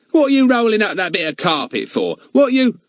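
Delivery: loud, high Cockney shouting. A narrator's reading. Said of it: "Fatty put on a real Cockney voice".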